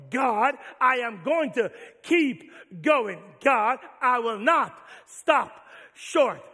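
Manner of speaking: loud, slow, building declaration; full-force preaching. Real, not acted. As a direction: proclaim it to the congregation.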